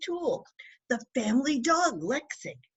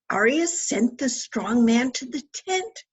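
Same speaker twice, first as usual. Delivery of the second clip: ordinary delivery, nothing marked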